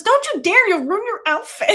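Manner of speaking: sassy mock outrage; bossy [name]. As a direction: stop her at once.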